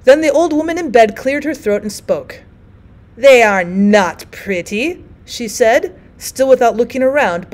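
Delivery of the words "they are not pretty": slow, precise and firm; very distinctly. Acted. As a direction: spell out every word. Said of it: "but very distinctly".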